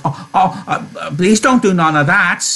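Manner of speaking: high, stammering, whimpered plea; frightened begging. Acted. Lenny panicking as she screams, begging in fright. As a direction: plead as if starting to cry. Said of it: "began to cry with fright".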